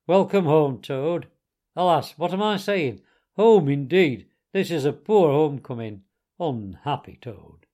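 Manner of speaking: loud, grave and solemn, slowing at the end; solemn greeting. A narrator's reading. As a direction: greet him like a mourner. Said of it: "came solemnly up to Toad".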